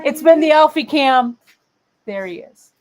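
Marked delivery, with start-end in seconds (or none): 0.0-1.4 high, loud show-host sign-off. Pretend. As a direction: announce it like a show ending.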